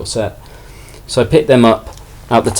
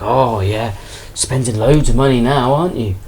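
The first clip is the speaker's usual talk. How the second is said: imitating a mocking stallholder; mock-impressed dig, lifting at the end; sarcastic; 2 semitones higher than usual. Stallholder jibing at a customer paying ten p, sarcastic. Stallholder mocks small purchase, retold.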